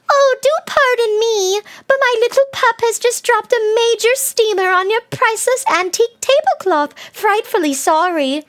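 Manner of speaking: put-on high-pitched, dainty English girl; dainty English accent. English movie girl apologizing for her pup, dainty English accent. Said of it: "it had this one girl from England in it, and everything she said sounded smart and dainty".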